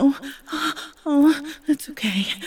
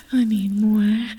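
ordinary talk, nothing marked

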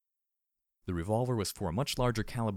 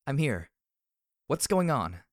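raised, higher-pitched call; concerned calling out; 6 semitones higher, 5 dB louder, slower than usual. A narrator's reading. Heroine lights up to find Glory, calling out. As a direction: call to a friend in the dark.

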